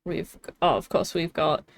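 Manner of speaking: usual speaking voice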